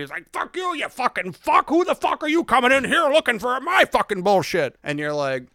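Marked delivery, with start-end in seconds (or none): 0.3-4.8 much higher, shrieking rage of a nasty bootlegger; angry high shout. Acted. The hostile booze dealer yelling at the intruder, high furious shriek. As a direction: spit the curses up high like a furious little crook.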